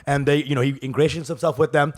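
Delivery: ordinary delivery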